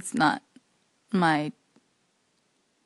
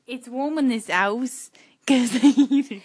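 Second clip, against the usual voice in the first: slow, louder mock Australian "It's warm in this house"; copying Australian accent; 6 dB louder than usual. Sister copying the Australian line, slow loud Aussie accent. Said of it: "I'm good at copying".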